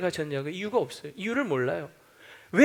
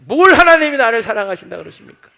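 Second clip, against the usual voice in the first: louder, higher scoffing retort; talking back; 4 semitones higher, 12 dB louder than usual. Retold or imitated. Doubter talking back to God, loud and scoffing. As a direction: scoff back at God's love. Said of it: "자꾸 토달지 마세요".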